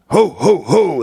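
high mock Santa ho-ho; Santa laugh. Comic showing Santa's accent as a joke, high hooting impression. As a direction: hoot it out as a playful Santa. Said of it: "That's Santa".

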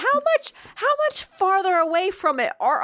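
high, rushed disbelief; can't believe the logic, pitch flying up; incredulous. Real, not acted. Co-host questions how far they drove from the baby, incredulous.

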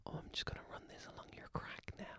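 hushed mock-sweet whisper; barely audible, whisper it as the kindly nurse; whispered old nurse. Old lady nurse to patient before swabbing, whispered.

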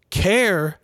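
"care" hit high and loud; sharp, loud, high "care"; stress on care. Shown as a demonstration. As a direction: punch the word "care". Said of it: "ding, ding, ding".

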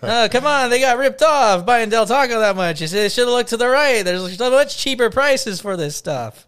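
loud, high comedy-club delivery; hammed-up comic belting out a gag; mock stand-up comic. Co-host doing his stand-up joke, loud mock comic. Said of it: "my stand-up joke".

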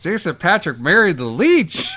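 loud, high, cracking up; laughing exclamation. Real, not acted. Comic realises the leech got married, shouting with laughter. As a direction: shout it through real laughter.

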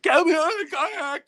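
high, loud panicked plea; retold panic. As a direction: imitate the sister's frantic begging from the water. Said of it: "she goes in full-blown panic".

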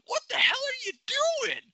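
high cracking shout of disbelief; angry yell. Retold or imitated. Fan yelling at his team on TV, furious high-pitched shout. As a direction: scream it at the TV like your team just blew it. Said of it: "I got angry after I saw that".

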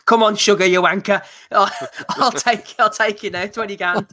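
playful fake fighter taunt; mock fighter callout. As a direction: jeer at him like a cocky boxer daring a rival. Acting a fighter calling out Sugar.

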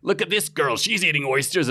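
hint of an American dad pointing at a girl; American accent. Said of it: "he was like".

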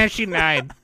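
loud, high mock laugh into the words; hammed-up cackling delight at a death; imitated laughing. Co-host imitating friend who finds death hilarious, mock laughing. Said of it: "You're just like".

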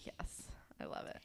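hushed delighted whisper; whispered glee. Pretend. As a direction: breathe it out like a secret thrill. Reaction quoted in a whisper.

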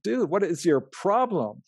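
slow, high, fed-up protest; exasperated. Retold or imitated. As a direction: drag it out, totally fed up. Guy keeps attacking him.

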